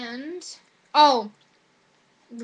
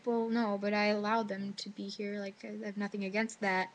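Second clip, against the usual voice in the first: mild unbothered reply; 3 semitones lower, 7 dB quieter than usual. Retold or imitated. Teacher calmly answers the officer.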